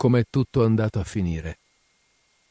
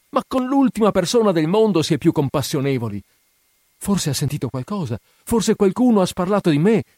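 much higher and louder, pleading anguish; distraught girl crying out to the narrator; desperate cry; 10 semitones higher, 4 dB louder than usual. A narrator's reading. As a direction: cry out, begging him to explain. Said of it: "gridò".

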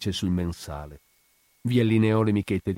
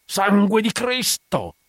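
furious, high, drawn-out roar of an oath; Ferdinando's yell; 12 semitones higher, 5 dB louder, slower than usual. A narrator's reading. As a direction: bellow the curse slowly and loudly. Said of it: "urlò Ferdinando". (urlo furioso, imprecazione a piena voce)

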